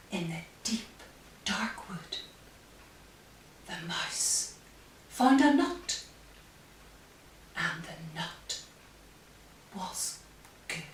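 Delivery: soft storyteller's close; hushed ending. A narrator's reading. Storyteller closes in the quiet wood, hushed. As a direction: bring the tale down to a hush.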